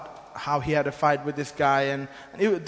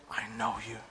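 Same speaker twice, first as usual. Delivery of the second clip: low, quiet, unsettling growl-like murmur; eerie possessed voice; 7 semitones lower, 10 dB quieter than usual. Retold or imitated. Possessed man telling the pastor he knows him, low eerie voice. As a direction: imitate a demon speaking through a man, much lower and softer.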